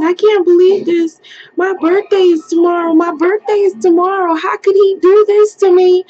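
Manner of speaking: high, fast, tearful wail; retold crying. Released driver wailing to the officer, high and tearful. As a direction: spill it out between sobs. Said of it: "I was crying hard".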